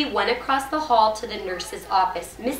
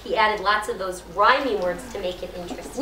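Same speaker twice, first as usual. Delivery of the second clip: plain reading voice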